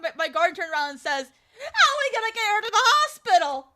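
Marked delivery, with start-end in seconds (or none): 1.3-3.7 high, loud protest; imitating an incredulous guardian; exasperated retort. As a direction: argue back at raised pitch. Guardian argues back loudly.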